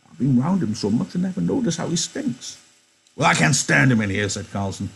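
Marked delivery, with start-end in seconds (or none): 0.1-2.6 put-on high, thin old-timer's voice; old Candy voice. Old Candy greeting Slim and George, high-pitched. Candy apologizing for his dog. 3.2-4.3 loud and disgusted, higher pitched; loud disgusted Carlson. Acted. Carlson ordering Candy to remove the stinking dog, loud.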